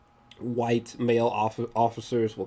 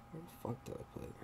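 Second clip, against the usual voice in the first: whispered quick curse under the breath; muttered to self; 4 semitones higher, 21 dB quieter, much faster than usual. Real, not acted. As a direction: mutter it to yourself while searching. Searching for picture, muttering to self.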